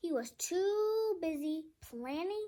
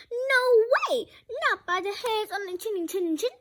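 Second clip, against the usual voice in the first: crying out; 7 semitones higher, 9 dB louder than usual. A narrator's reading. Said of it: "cried the three little pigs".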